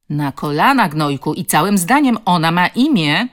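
loud, angry order; stern command. A narrator's reading. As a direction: demand a proper apology forcefully. Woman sternly orders the bully.

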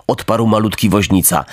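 plain reading voice

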